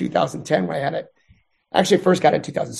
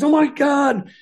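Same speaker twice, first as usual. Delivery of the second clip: alarmed; 7 semitones higher, slower than usual. Retold or imitated. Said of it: "And she's freaking out".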